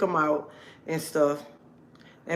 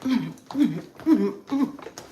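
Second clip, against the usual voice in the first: high giddy laugh; 6 semitones higher than usual. Real, not acted. Grinning at viewers about the rose, giddy laugh. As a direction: laugh with delight. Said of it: "smile came when I started talking".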